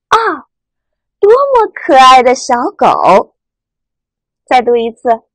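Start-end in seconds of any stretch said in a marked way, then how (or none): 0.1-3.4 slow delighted 啊 falling, 多么可爱; admiring exclamation. Shown as a demonstration. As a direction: gush over a cute puppy, slowly. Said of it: "读第四声 感叹". (赞叹的语气，慢慢读)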